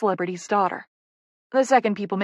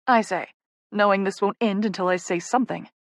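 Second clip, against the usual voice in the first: usual speaking voice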